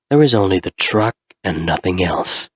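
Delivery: usual speaking voice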